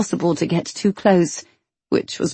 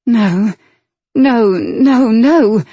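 urgent breathless no; 5 semitones higher, 6 dB louder, much slower than usual. A narrator's reading. Heroine's urgent protest.

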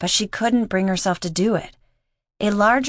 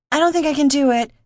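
mild yell, higher and quicker; understated narrator's version of a frightened yell; panicked yell; 8 semitones higher, 4 dB louder, faster than usual. A narrator's reading. New diver to the others before her back roll, mildly yelling. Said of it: "she yelled".

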